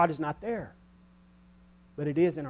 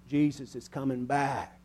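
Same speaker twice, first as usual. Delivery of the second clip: usual speaking voice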